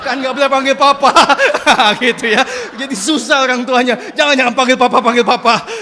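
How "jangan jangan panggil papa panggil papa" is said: much louder mock protest; comic raised-voice pleading; flustered parent. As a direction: protest loudly as a muddled dad. Voices flustered parent while audience laughs.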